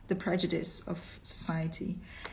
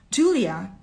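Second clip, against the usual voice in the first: ordinary delivery, nothing marked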